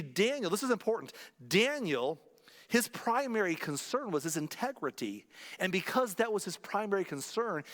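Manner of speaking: ordinary talk, nothing marked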